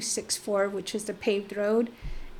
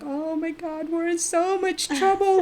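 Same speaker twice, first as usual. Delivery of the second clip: crying; 8 semitones higher, 3 dB louder than usual. Retold or imitated. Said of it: "And we start crying".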